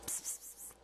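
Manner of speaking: soft hissed psst to a pet; psst call. Real, not acted. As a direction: call the pet over with tiny hushed pssts. Calling a pet over.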